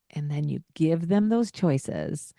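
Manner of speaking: usual speaking voice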